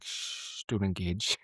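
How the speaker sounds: whispered and soft; hushed whisper. Retold or imitated. Oz warning Xander not to engage, whispered. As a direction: hush him quietly. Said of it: "Oz is like".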